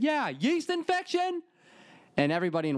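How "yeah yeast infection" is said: high, slow mock yell. He yells the embarrassing item across Walgreens, high and slow. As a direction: belt it out high and drawn out. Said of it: "swallowed all my pride and yelled at".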